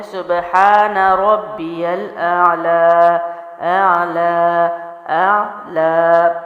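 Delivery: very slow chanted اعلی with a clear ain; Quranic recitation. Shown as a demonstration. Teacher recites from prayer to show ain, slow chant. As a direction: recite slowly in tajweed chant. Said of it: "تو اس کی ادائیگی ہے".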